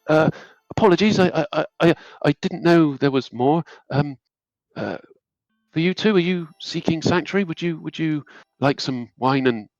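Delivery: nervous acolyte. Acted. Stammering acolyte offers wine.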